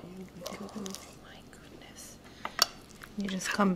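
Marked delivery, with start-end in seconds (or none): 0.0-2.2 breathless whispered wonder. Real, not acted. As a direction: whisper it in awe. Whispered reaction.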